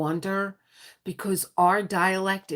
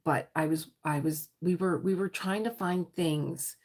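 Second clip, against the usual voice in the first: ordinary talk, nothing marked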